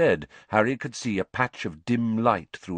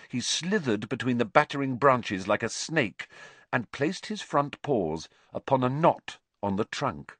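plain reading voice

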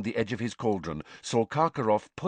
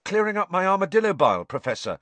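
much higher, louder and fast, overly innocent; innocently; 10 semitones higher, 6 dB louder, faster than usual. A narrator's reading. Harry answering Snape caught eavesdropping, innocent. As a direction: play the innocent a bit too eagerly. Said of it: "said Harry innocently".

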